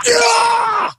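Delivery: very high shouted yelp; officer yelling in pain. Retold or imitated. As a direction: scream as the needle goes in. Needle plunged in.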